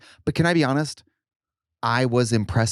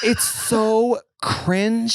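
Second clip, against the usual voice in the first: very slow, stretched-out cringe; cringing; 9 semitones higher, much slower than usual. Real, not acted. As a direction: drag out cringe with disgust. Drawn out cringe.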